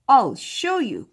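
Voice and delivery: loud, offended dragon cry; angry dragon cry. Acted. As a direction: shout it to prove her wrong. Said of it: "cried Fred".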